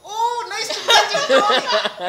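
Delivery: high, loud, slow mock Scottish accent, laughing; copying Tony's accent. Strangers greeting Tony, mimicking his Scottish accent loudly. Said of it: "people just adopt his accent".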